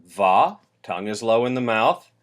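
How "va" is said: regular hard V. Shown as a demonstration. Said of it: "the regular V".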